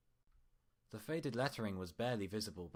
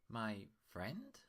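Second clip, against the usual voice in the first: a mild doubtful questioning lilt; 2 semitones higher than usual. Acted. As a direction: ask it guarded, not daring to hope. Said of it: "she asked doubtfully".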